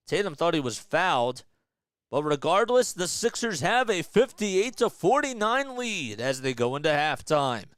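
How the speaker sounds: lively sports announcer read; play-by-play commentary. Acted. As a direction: call the game like a broadcaster.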